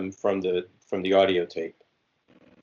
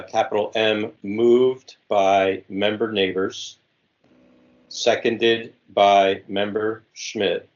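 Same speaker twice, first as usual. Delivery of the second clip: dictating. Shown as a demonstration. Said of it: "Okay, I am ready again".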